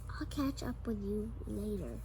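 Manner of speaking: quick whisper. Acted. Sam slipping off from the class, whispering. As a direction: hush it as he slips away. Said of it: "Sam said as he dashed".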